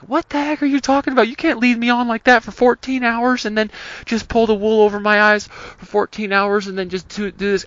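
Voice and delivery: high, fast mock indignation; a squeaky, hammed-up offended rant; mock outrage. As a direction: ham up the offended boyfriend. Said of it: "about to be like".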